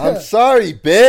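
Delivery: high, shouted comeback; loud mock apology. Real, not acted. Co-host told to speak up, shouting a mock apology.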